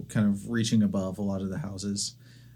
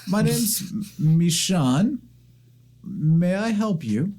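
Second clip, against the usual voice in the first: put-on high, slow elderly temple keeper; old woman greeting visitors, high and kindly; 9 semitones higher, 3 dB louder, slower than usual. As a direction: speak up politely as an old librarian.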